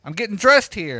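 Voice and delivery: yelling through the door; calling out. Retold or imitated. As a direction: call out to be heard through the door. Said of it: "So I call out to her".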